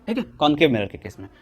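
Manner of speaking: ordinary delivery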